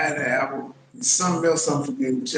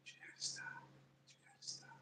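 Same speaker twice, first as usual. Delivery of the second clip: whispered; 30 dB quieter, much faster than usual. Real, not acted. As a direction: drop to a whisper. Repeats line, then whispers.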